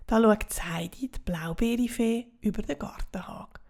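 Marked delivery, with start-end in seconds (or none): none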